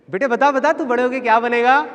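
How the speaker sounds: high, loud prodding question; coaxing relative. Retold or imitated. Relatives ask the boy what he will become, coaxing. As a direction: imitate an eager aunt quizzing a kid.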